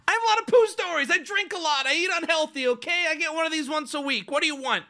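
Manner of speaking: high, quick defensive rant; mock defensive. Comic answering a rerun complaint, indignantly defensive. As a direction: protest in a high, rapid run.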